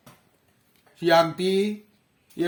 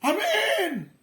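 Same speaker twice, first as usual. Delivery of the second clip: distant call; 13 semitones higher than usual. Retold or imitated. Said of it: "seperti ada yang manggil".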